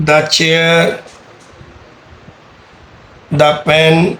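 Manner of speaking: دا said as short "da" before chair and پین; the before consonant. Shown as a demonstration. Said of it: "اگر کانسوننٹ کی بات کریں". (دا کو چھوٹا "دا" بولیں)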